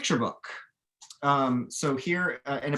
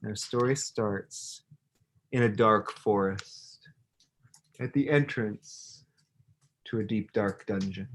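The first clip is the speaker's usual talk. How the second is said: put-on slow, hushed read-aloud to children; slow storyteller voice setting a spooky scene; storybook narration; 3 semitones lower, slower than usual. A narrator's reading. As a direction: draw the kids into a dark forest, slowly.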